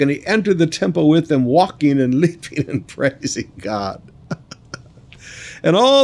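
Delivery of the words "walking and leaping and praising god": laughing. Real, not acted. Preacher laughs with joy reading.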